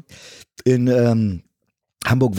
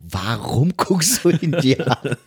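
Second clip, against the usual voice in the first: puzzled director; 2 semitones higher, much slower than usual. Retold or imitated. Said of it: "Und er so, so".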